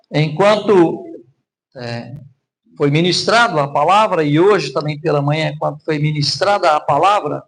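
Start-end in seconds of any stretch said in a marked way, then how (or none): none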